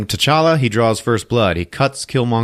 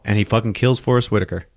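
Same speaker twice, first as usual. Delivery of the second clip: usual speaking voice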